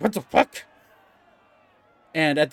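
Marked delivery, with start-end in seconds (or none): 0.0-0.6 shocked yelp. Acted. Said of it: "It's like".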